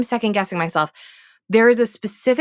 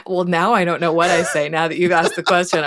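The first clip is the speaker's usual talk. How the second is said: ordinary delivery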